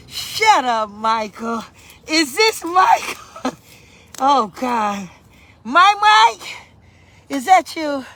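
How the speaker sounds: loud, excited disbelief; real surprise. Real, not acted. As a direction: greet someone you never expected to turn up. Delighted surprise at Michael joining.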